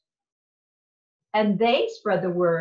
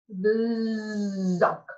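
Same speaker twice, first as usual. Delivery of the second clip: usual speaking voice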